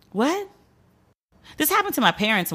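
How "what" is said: high, sharp rising disbelief; incredulous. Real, not acted. Comic reacting to the store policy, incredulous. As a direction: blurt it out in disbelief.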